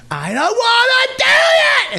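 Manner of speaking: loud, very high tantrum shriek, drawn out; imitated child's scream. Father imitating his son's tantrum, slow screamed protest. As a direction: scream it like a kid mid-rage. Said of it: "and screamed".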